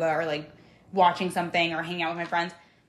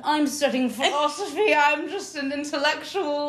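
higher, slowed, hammed-up posh scholar voice with a laugh; mock intellectual; 7 semitones higher than usual. Pretending to be an Oxford student to her cohost, hammed-up higher scholar voice. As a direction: play the smug Oxford philosophy student, half laughing.

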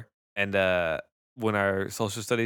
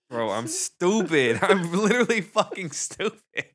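high and giggly; laughing at yourself while stoned; 7 semitones higher than usual. Real, not acted. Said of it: "The edibles hit".

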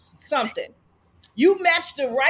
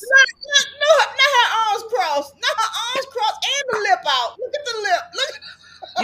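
teasing, excited; 10 semitones higher, 5 dB louder than usual. Acted. Teasing a sulking cohost.

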